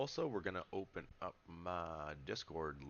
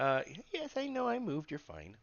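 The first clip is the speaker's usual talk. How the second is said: cat talk; 11 semitones higher, 6 dB louder than usual. Real, not acted. Talking to the cat.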